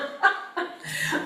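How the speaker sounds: high, airy real laugh; helpless giggle, high-pitched; trailing laughter. Real, not acted. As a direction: let the giggles run out. Laughing at own correction.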